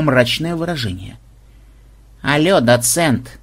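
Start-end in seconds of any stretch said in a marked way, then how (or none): none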